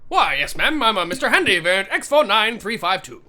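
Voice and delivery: sing-song, quick robot butler patter; eager robot servant. Acted. Robot introducing itself to a ma'am, chipper servant voice. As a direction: rattle off the model number with courteous pep.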